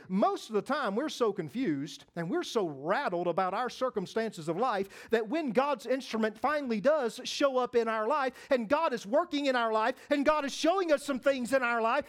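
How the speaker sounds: ordinary delivery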